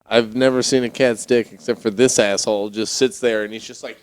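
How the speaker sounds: ordinary delivery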